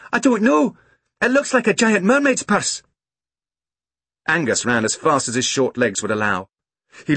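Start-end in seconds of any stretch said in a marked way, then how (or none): 0.1-2.8 put-on high child's call across distance; excited calling. A narrator's reading. Girl telling mother about her find, calling out.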